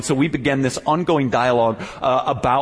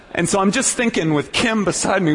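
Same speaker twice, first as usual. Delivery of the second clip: ordinary delivery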